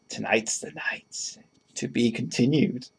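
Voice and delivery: slow breathy cartoon whisper; cartoony whisper. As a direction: hush it like a cartoon teaser. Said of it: "let's read it in a cartoony voice".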